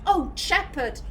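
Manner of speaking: hammed-up lover greeting her returned hero; mock-romantic sigh of a reunited sweetheart; swooning Liara. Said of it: "Liara going".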